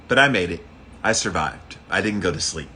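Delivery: ordinary delivery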